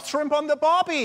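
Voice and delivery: put-on high, loud jokey Australian accent; mock Australian accent. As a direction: toss off a cartoon Australian line. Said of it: "Australian, that'd be fun".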